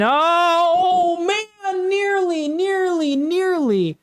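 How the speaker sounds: high-pitched, straining nearly nearly; leaping up as the header almost goes in; near-miss excitement. Real, not acted. Streamer watching a header nearly score, excited high-pitched groan.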